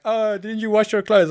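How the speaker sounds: high, loud teasing question; mock coworker question. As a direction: tease like a nosy coworker. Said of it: "They're like".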